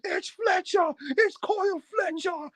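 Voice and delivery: very high frantic commentator call; excited commentator. Retold or imitated. Nigel imitated calling the heel turn, very high and excited. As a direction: call it like a shocked announcer. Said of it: "you have, uh, Nigel like".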